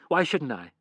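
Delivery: wife snapping at husband; snapped. Acted. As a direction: shoot it back sharply. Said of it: "she snapped".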